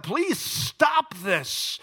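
anguished plea, drawn out slowly; desperate begging. Acted. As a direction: beg God to make it stop. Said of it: "And I begged God".

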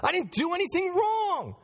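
lightly voiced prisoner protesting his innocence; Joseph complaining. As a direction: Joseph pleading from jail, a touch aggrieved. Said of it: "That's what Joseph was saying".

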